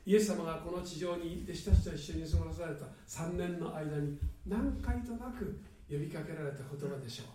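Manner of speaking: ordinary talk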